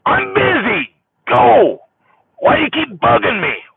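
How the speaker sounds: put-on high, loud annoyed snap at the kid; irritated yell. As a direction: brush the child off, irritated. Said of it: "when I'm yelling at her".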